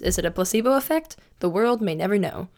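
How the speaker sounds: hammed-up commercial voice; ad read. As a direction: play it like a cheesy radio ad. Mock sponsor ad read.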